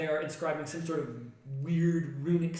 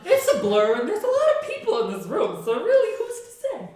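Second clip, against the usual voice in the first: mock dramatic announcement; 6 semitones higher, 11 dB louder than usual. GM joking loud mock spell.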